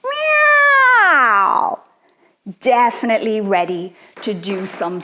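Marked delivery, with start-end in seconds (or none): 0.0-1.8 very high, loud swooping meow; scariest meow. Shown as a demonstration. Teacher asking kids for the scariest meow, high loud yowl. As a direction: screech it down like a scary cat. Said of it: "Who's got the scariest meow?".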